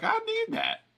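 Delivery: very high, tiny pleading squeak. Retold or imitated. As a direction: beg for it back in a small high voice. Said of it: "you're like".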